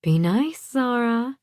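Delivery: lightly voiced warning mother, lifted pitch; mother's voice. A narrator's reading. Mother in daughter's head urging manners, lightly voiced warning. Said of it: "can hear my mother's voice".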